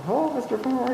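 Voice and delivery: high, lightly voiced skit character; a quick high sing-song call from a safety skit. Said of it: "you remember that little skit?".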